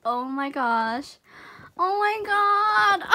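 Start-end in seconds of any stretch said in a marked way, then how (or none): 1.8-3.1 high, drawn-out exclamation; amazed delight. Real, not acted. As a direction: draw it out, thrilled by the gift. Reacting to surprise gift.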